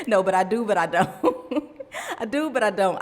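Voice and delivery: amused, laughter breaking in; laughing. Real, not acted. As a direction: admit it through real laughter. Laughing at her own contradiction.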